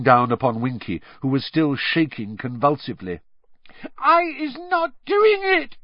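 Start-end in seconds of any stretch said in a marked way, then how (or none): 4.0-5.8 very high, slow squealing elf voice; terrified elf squeal. A narrator's reading. House-elf squeals her innocence to Diggory, terrified. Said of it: "she squealed".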